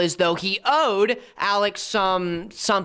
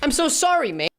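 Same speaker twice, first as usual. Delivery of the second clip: a light mock British accent, higher; 7 semitones higher than usual. Commentator joking to co-host in a light mock British accent. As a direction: toss off a cheeky British "sorry mate". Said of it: "I'm not doing an accent".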